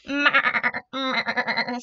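stuttering imitated goat cry; goat bleat. A narrator's reading. Goat calling to the spider, rattling bleat. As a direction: bleat with a loud start, like a goat. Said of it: "said the goat".

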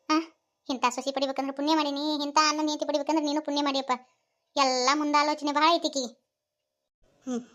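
usual speaking voice

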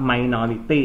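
"minority" read slowly, rising at the end; model reading. Shown as a demonstration. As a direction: model "minority" for the class to repeat. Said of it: "อ่านข้อสี่ครับ". (อ่านคำว่า "minority" ช้าๆ ให้นักเรียนอ่านตาม)

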